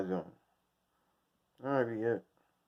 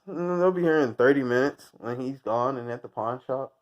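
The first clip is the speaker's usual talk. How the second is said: normal talking voice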